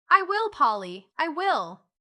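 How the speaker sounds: high, loud, eager promise; excited child agreeing wholeheartedly; eager cry. A narrator's reading. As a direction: cry out as a won-over little boy. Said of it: "cried Joel".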